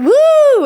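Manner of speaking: high, loud drawn-out "woo"; imitated party whoop. Mimicking Will's clip in the pool, party whoop. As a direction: mimic a long, excited whoop. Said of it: "the one of Will going".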